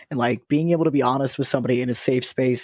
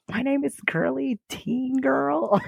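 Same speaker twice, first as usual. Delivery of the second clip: put-on high, slow girly voice; girl voice; 10 semitones higher, slower than usual. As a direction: squeak it out like a teen girl. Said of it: "I can't do it".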